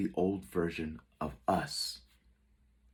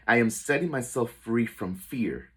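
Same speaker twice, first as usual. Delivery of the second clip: loud, higher-pitched empowered affirmation; declaration with conviction; 4 semitones higher, 7 dB louder than usual. Shown as a demonstration. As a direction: proclaim it out loud with inner faith. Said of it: "say it with power".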